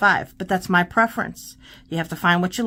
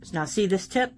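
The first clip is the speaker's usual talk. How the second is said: usual speaking voice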